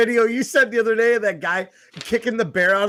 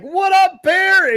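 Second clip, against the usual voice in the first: loud, high mock holler at a bear; acted-out shouted hello; boisterous greeting; 10 semitones higher, 7 dB louder, much slower than usual. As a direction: hail the bear loudly like a buddy. Acting out greeting a sleeping bear.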